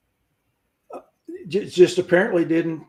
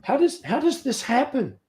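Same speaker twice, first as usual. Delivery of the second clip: incredulous; 9 semitones higher than usual. Retold or imitated. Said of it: "I'm like".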